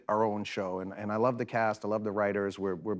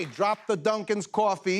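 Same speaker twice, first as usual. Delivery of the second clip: put-on higher-pitched New York cop accent; 6 semitones higher than usual. Cop ordering a suspect, New York accent. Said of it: "in my New York accent".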